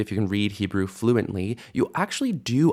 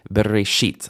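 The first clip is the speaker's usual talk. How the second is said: BERESHIT with the weight on the last syllable, SHIT; final stress. Shown as a demonstration. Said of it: "final stress there".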